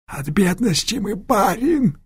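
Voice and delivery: high, strained pleading croak; wounded man wheezing. Acted. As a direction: wheeze it out in pain. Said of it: "прохрипел раненый".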